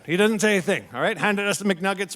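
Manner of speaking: normal talking voice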